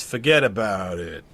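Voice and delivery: Italian mobster, drawn out with a downward motion; slow Italian-American wiseguy accent. Shown as a demonstration. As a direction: do an Italian mobster, inflection going down. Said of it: "instead of going in Italian".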